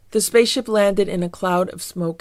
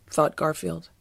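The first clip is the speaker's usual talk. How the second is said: plain reading voice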